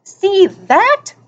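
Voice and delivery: high, sharp rebuke; snapped. A narrator's reading. Girl scolding the boy she just woke, snapping. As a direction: snap it upward as a challenge. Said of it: "she snapped".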